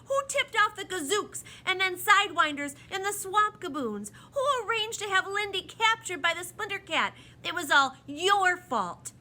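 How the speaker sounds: loud, high-pitched outburst; angry yell. A narrator's reading. Tom yells back at the towering proc, furious. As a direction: hurl accusations at full force. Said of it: "Tom was so angry that he yelled at".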